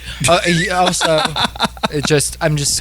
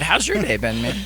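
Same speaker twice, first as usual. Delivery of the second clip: ordinary delivery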